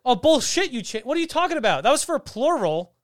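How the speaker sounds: loud, high-pitched objection; indignant protest. Real, not acted. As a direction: argue back hotly, pitch jumping up. Protesting a drinking-game call.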